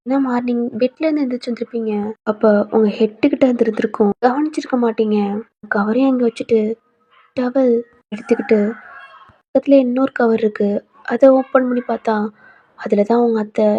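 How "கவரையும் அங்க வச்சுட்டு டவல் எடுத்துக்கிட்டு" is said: plain reading voice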